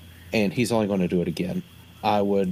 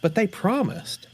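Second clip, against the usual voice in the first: high, pouty disbelief; naive disappointment; 10 semitones higher than usual. Acted. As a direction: let down like a child. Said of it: "I'll look at the woods like".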